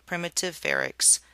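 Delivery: plain reading voice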